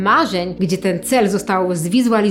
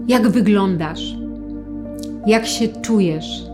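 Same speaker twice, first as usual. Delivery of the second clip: calm them with long pauses. Acted. Leads a guided visualization.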